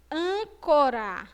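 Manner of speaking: Âncora slow, closed nasal â. Shown as a demonstration. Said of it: "som fechado ... circunflexo". (Âncora devagar, â fechado)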